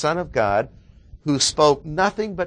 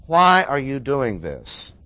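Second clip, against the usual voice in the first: normal talking voice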